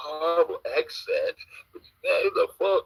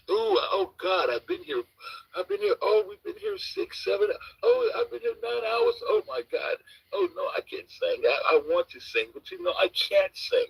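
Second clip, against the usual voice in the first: much higher, whining mock lament with a fake cry; mock whiny wail; 7 semitones higher than usual. Mocking the Zombie's long wait, in a high fake whine. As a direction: wail like a self-pitying crybaby.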